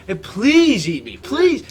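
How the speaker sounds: high, loud, slow mock begging; drawn-out mock plea; Ryan impression. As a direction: plead loudly, hammed up. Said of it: "That was spot on".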